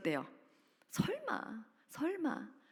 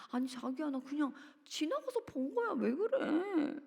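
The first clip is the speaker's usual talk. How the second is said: put-on higher, slowing, puzzled boyfriend protesting; baffled boyfriend; 7 semitones higher than usual. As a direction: play the confused boyfriend, drawn out. Boyfriend defends himself. (의심받는 남자친구가 당황하며 느리게 항변하는 목소리)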